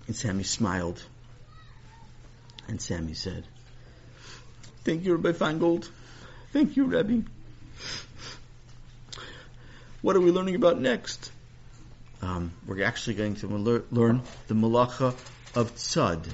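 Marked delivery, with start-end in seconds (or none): 4.8-11.3 put-on high, eager little boy; bright grateful kid, pitched high; young boy voice. Sammy voiced by narrator.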